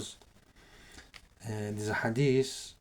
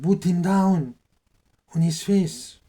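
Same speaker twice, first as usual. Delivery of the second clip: high, forceful retold command; retold shouted order; 6 semitones higher, 9 dB louder, slower than usual. Tyrant orders guards to force him down, retold command. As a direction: bellow the order in the retelling.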